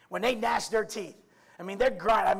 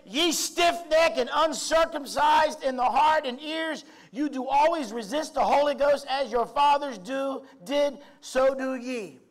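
raised, louder scripture read from the pulpit; lightly heightened sermon reading; preached scripture; 5 semitones higher, 4 dB louder than usual. Acted. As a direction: proclaim the verse like an accusation.

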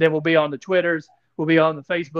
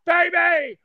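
hyped shout; 9 semitones higher than usual. Real, not acted. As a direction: belt it out like a race announcer. Hyped call about pole position.

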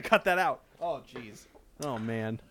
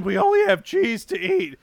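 high panicked crewman; 14 semitones higher, 5 dB louder than usual. Acted. Acting a crewman.

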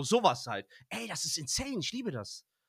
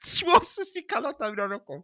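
cracking up mid-line; 6 semitones higher than usual. Real, not acted. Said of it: "der Lachanfall hier".

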